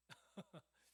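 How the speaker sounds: short, breathy chuckle; amused chuckle. Real, not acted. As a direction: chuckle at the hesitant response. Laughs at congregation's hesitant answer. (피식 터지는 웃음)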